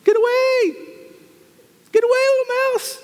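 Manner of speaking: high, pleading squeal of warning; warning the mouse. Retold or imitated. Viewer pleading with the mouse on screen, high squeal.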